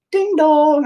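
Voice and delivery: high sung ding dong; playful high doorbell chime; mock doorbell. Mock porno plumber doorbell.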